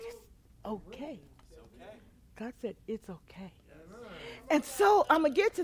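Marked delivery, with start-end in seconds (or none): 0.0-3.5 gentle reassurance. Retold or imitated. God's gentle reassurance retold.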